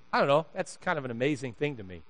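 ordinary delivery

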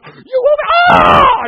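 imitating an overjoyed mom shrieking, very high and loud; acted-out squeal of astonished joy; delighted scream. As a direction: burst into a high, thrilled scream. Mom's delighted reaction, acted out.

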